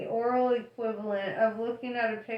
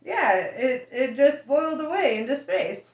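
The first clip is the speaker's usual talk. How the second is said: laughing; 4 semitones higher, 6 dB louder than usual. Acted. Ford cheerfully reports Earth destroyed.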